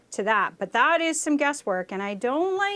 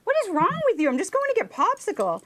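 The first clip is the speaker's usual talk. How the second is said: retold bafflement; 9 semitones higher, faster than usual. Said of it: "And I was like".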